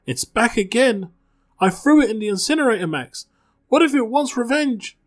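high, loud, fast panicked babble; terrified. Acted. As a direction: voice cracking up in sheer fright. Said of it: "eyes wide with terror".

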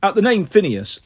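ordinary delivery, nothing marked

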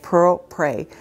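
ordinary talk

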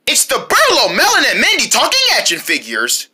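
normal talking voice

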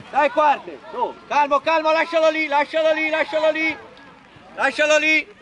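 rapid-fire repeated sideline order; shouted coaching calls. Real, not acted. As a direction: call it out insistently across the pitch. Coach calling instructions to player.

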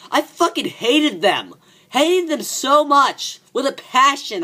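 high, slow and emphatic; passionate. Real, not acted. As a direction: drag it out with passion. Childhood villains.

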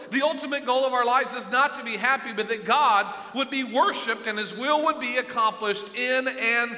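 loud, high revival preaching with music behind; full-force preaching. Acted. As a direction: build the sermon to a ringing peak.

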